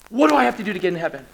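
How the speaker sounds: acted desperate plea, rushed question then insistent begging; pressing, pleading urgency; urgent begging. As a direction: beg him urgently for the answer. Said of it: "so there's this urgency".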